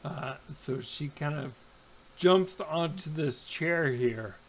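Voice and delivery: normal talking voice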